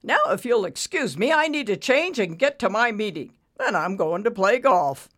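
loud, breezy brush-off; jolly him along dismissively; arrogant banker, breezy. A narrator's reading. Banker brushing off stricken borrower, breezy. Ricky's character voice.

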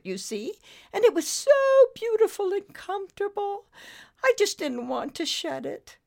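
high, rueful British confession; sighing British lady. A narrator's reading. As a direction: lament your fault as a grand British dame. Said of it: "Lady Meander sighed, then continued".

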